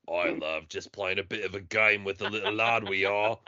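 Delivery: soft fake English lad voice; mock British accent. Chatting up the mum, soft mock British. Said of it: "you're just like".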